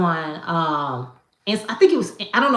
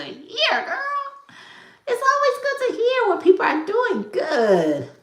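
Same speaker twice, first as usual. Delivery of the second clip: much higher, singsong reassurance; high cooing kindness; high sweet voice. Real, not acted. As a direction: lift it way up, sweet and friendly. Responding warmly to viewer.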